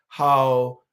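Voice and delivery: normal talking voice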